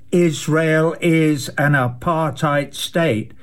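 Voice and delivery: slow, each word weighted; emphatic declaration. Real, not acted. Repeating the verdict for emphasis, slow emphatic. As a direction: lay it down deliberately, slowly.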